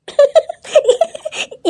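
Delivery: high, teasing giggle. Acted. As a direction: laugh at her, high and mocking. Women tease about the mirror.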